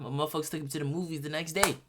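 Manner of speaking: ordinary talk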